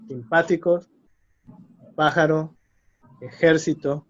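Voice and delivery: simpático, pájaro, ejército stressed third syllable from the end; antepenultimate stress. Shown as a demonstration. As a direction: say sim-PÁ-tico, PÁ-jaro, e-JÉR-cito for "simpático, pájaro, ejército".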